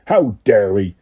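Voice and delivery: low growled outrage; a low angry rumble. A narrator's reading. Hagrid to Harry walking, low angry growl. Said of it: "Hagrid growled".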